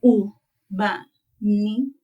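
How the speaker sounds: "Ubani" drawn out very slowly, each sound clear; slow model word. Shown as a demonstration. IsiZulu teacher modelling "Ubani" slowly for students. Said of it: "let me say the word".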